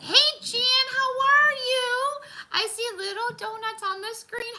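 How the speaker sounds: high, drawn-out sing-song hello; loud high welcome, slow and stretched; excited greeting. Real, not acted. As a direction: call out happily to a friend. Greeting viewer in chat.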